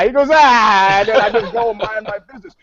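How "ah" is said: loud, drawn-out "ah"; mock yell. Cop answering a turnstile jumper, mock yell. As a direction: holler a long "ah" back.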